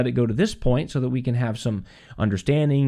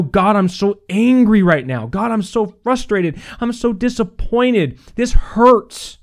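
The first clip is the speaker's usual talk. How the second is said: raised, loud, pained complaint to God; mock angry prayer; 8 semitones higher, 6 dB louder, slower than usual. Preacher voicing an angry prayer to God, loud and pained. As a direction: pour out frustration, pitched up and loud.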